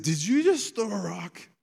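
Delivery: high, loud scolding outburst; puffed-up confrontation. Retold or imitated. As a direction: demand it like an outraged parent. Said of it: "puffy chest mode".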